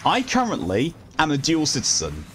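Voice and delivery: ordinary talk, nothing marked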